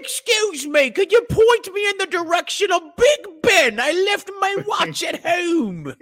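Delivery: hammed-up British accent, high and loud; mock British tourist. Co-host joining the joke as a British tourist, high and loud. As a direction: ask for Big Ben in a squeaky posh-tourist accent.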